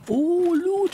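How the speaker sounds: gasp, then high delighted "oh, loot"; high-pitched thrilled exclamation; excited discovery. Real, not acted. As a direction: squeal it on spotting treasure. Spots loot in the game.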